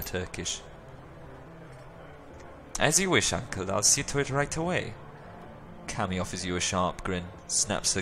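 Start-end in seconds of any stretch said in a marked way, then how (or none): 2.8-4.9 high, perky niece; young woman voice. Acted. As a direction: chirp it back sweetly. Cammy voice, high.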